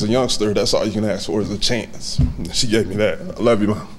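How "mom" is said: usual speaking voice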